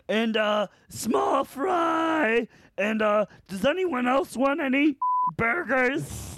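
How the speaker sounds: very high, stretched caricature; mock tonsil-trouble girl, high-pitched; loudmouth twin. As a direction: imitate her prank order at the speaker. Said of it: "they're all loudmouth".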